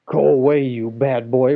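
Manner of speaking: loud scolding; cross ogre's wife. A narrator's reading. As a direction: shoo the boy off loudly. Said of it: "replied the ogre's wife".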